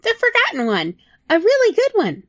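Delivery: easy, breezy yes; high little rat voice; chirpy rat. Acted.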